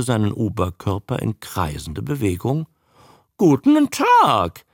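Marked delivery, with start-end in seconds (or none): 3.4-4.7 slow, high, loud croak; drawn-out high creature voice; croaking newt. A narrator's reading. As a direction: squawk it back like a parroting newt. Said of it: "krächzte er".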